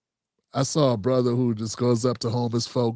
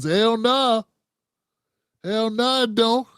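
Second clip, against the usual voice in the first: grandma's retort; 7 semitones higher, slower than usual. Retold or imitated.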